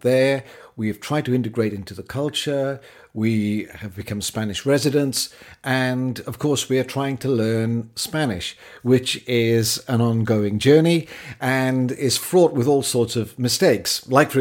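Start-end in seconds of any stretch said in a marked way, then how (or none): none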